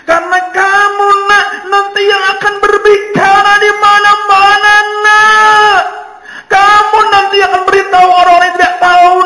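high, loud, ringing proud voice; a mother proudly declaring her son's future; mother proclaiming loudly with pride, never harsh; loud, not scolding. Retold or imitated. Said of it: "itu suaranya lantang".

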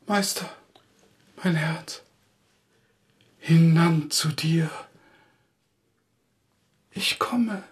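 soft, fading last words into a whisper; dying fade. Acted. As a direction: let the life drain out of each word. Poet fading away as he dies. (leise, ersterbende letzte Worte bis zum Flüstern)

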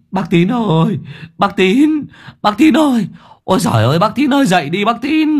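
high, loud calling out; frantic high calls at the gate; yelling. Acted. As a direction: call him loudly out of bed. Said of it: "Nghe tiếng gào inh ỏi".

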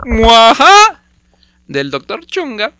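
loud evil laugh, then sinister; mad scientist. Pretend. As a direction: cackle like a mad doctor. Villain laugh and doctor name.

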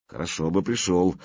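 usual speaking voice

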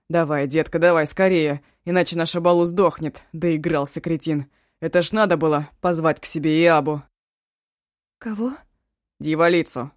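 loud exasperated tirade; angry urgent ranting. Acted. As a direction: rant and order her, fast. Eshu rants and orders Eva.

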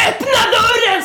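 high, loud angry demand; screaming. Retold or imitated. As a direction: mimic an enraged man screaming to be let in. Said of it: "skriker han".

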